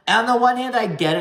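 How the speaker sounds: high, loud burst of emphasis; animated concession; emphatic. Real, not acted. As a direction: jump in with energy.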